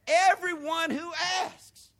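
high loud slow Everyone, pause after; shouted emphasis on everyone. Shown as a demonstration. Pastor stressing everyone to congregation, high and shouted. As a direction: shout Everyone who asks slowly. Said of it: "There's a comma there".

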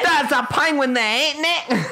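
high-pitched exaggerated British accent. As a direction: squeal it in hammed-up British. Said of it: "If I like to impersonate British people".

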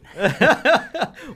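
loud, high burst of laughter; real amused laugh. Real, not acted. Laughs at the acronym question.

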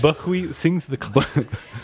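drawn-out, garbled comic skit voice; slow mush-mouthed character announcement; Buckwheat impression. Quoting a favorite Eddie Murphy skit, Buckwheat impression. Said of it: "that's one of the best skits".

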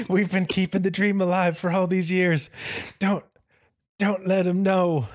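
high-pitched mock dying plea; hammed-up deathbed wish; dying soldier. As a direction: beg with your last breath, melodramatically. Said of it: "his dying wish was".

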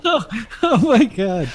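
a high, very slow, laugh-filled oh my God; laughing disbelief. Real, not acted. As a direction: gasp it out through laughter. Cohost laughing at threat story.

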